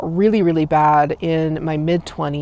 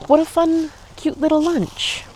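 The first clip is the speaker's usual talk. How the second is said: gushing; 11 semitones higher than usual. Real, not acted. Gushing over lunch.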